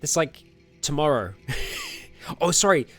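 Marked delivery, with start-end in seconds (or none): none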